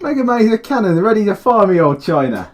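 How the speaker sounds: hammed-up Cockney, cheeky; Cockney put-on voice. Recording a game voice line in a hammed-up Cockney accent. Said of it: "redo that in your actual voice".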